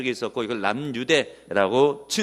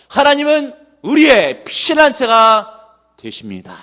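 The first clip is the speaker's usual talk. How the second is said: slow, loud, high-pitched proclamation; bold confession; 8 semitones higher, 10 dB louder, slower than usual. Retold or imitated. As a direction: declare the psalmist's faith boldly, drawing out each phrase. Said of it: "담대하게 고백을 하고 있는". (담대하게 선포하는 신앙 고백)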